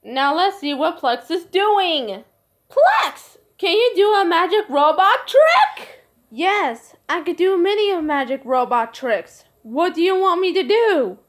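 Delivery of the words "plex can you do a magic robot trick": calling out. Acted. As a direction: call Plex over, pitched way up. Child calls out to robot.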